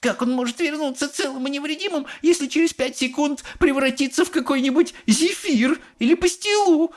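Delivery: very high-pitched indignant mother; snapping back. A narrator's reading. Mother challenging Wonka about her son, snapping. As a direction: snap it in a high, cross woman's voice. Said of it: "огрызнулась миссис Глуп".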